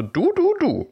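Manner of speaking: a touch of a scolding mother; mild scolding. Retold or imitated. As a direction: wag a finger, mildly scolding. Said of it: "im Film ist es so".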